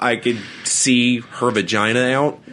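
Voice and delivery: usual speaking voice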